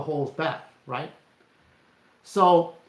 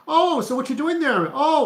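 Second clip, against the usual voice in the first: much higher, louder mocking cop voice; 12 semitones higher, 6 dB louder than usual. Cop questioning a passerby at night, hammed-up high mock voice. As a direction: play a smug suspicious cop shining a light. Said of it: "It's like a cop saying".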